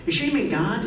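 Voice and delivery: incredulous question. Retold or imitated. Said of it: "I was like".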